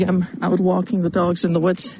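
ordinary talk, nothing marked

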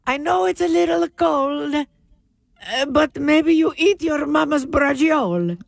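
high, playful Italian mama voice; Italian accent. Pretend. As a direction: ham up a thick Italian accent. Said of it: "joking Italian accent bit".

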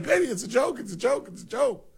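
a hint of mock panic; pleading mom. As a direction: mom calming an angry husband. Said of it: "and they're like".

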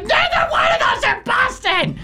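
very high-pitched mock scream; retold scream. Retelling a reaction to Conan O'Brien's Boston claim, mock scream. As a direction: screech it in exasperation at the TV, hammed up. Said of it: "And I just screamed".

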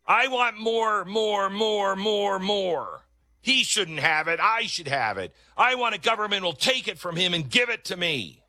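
mock a greedy socialist begging; high whiny mockery of an entitled voter. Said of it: "Everybody right now is like".

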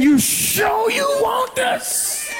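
very high, drawn-out dare; stretched, high-pitched preacher's challenge; challenging shout. Acted. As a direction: cry it out slowly as a warning. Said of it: "And the Lord is saying".

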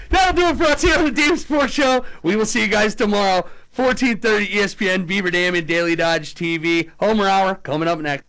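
a touch of sports radio announcer; radio host sign-off. Acted. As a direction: wrap up the broadcast with energy.

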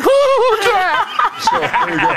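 very high fangirl squeal. Retold or imitated. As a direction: squeal like a starstruck fan. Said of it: "I was like".

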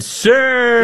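a high, lightly voiced wife; light imitation of his wife calling him sir; mimicking his wife. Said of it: "and she said".

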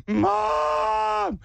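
high-pitched drawn-out wail for mom; crying kid. Retold or imitated. Hurt kid calling for his mom, mock crying wail. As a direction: imitate a small child bawling. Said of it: "'cause he's like".

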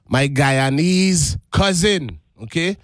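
ordinary delivery

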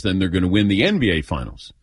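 ordinary talk

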